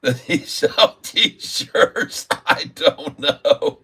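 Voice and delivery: giggling through the words, slowed down; real laughter breaking into the question; laughing. Real, not acted. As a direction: ask it while cracking up. Laughing at a silly username.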